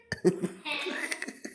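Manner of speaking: high, soft wheezy laugh. Real, not acted. Teasing the pet about being laughed at, laughing. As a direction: let a high giggle escape.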